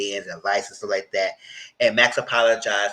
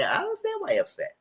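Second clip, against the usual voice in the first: way higher, quick and airy; mock sweet voice; 5 dB quieter, faster than usual. Host recalling her reply to Cali Boy, high mock sweetness. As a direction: say it in a prissy, sing-song falsetto. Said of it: "And I said".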